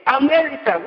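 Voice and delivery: usual speaking voice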